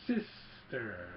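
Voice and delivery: low, drawn-out single word; lightly voiced villain, dwelling on the word; Vader. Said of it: "Vader's like".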